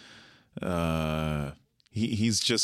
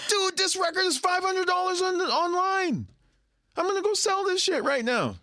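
very high, fast excited squeal; bursting with glee at the steal; giddy excitement. Retold or imitated. Bandmate gloating about a cheap record, high and excited. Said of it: "He's like".

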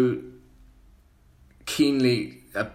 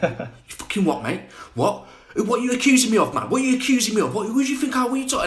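defensive scally; 11 semitones higher, much faster than usual. Retold or imitated. Tracksuit lad snapping at a stranger, high indignant outrage. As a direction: bristle up, nobody accuses you. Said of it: "went full on defensive".

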